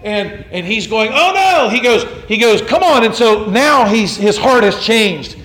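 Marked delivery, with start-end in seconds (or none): 1.1-1.7 high, loud alarmed yelp; panicked cry. Retold or imitated. Guards panic as Cranmer goes off script, yelping. 2.6-3.0 high, loud alarmed yelp; panicked cry. Retold or imitated. Guards panic as Cranmer goes off script, yelping.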